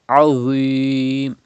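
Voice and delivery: "Azim" with dark dh and drawn-out ee; thick Dha. Shown as a demonstration. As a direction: make "Azim" heavy, with no buzz. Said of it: "with thicker voice".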